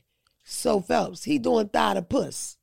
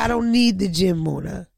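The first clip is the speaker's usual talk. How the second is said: Jamaican accent impression; 2 semitones lower, 5 dB louder than usual. Comedian imitating the Jamaican guide bragging, in a Jamaican accent. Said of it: "I don't know if that accent is Jamaican or African".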